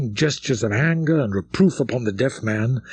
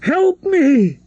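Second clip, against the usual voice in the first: very high, desperate shriek for help; a girl overpowered shrieks for rescue; 3 dB louder, much slower than usual. A narrator's reading. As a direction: scream with the last of her strength. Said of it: "she made a last effort and shrieked".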